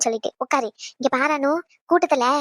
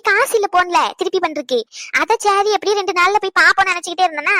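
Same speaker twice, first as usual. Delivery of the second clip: normal talking voice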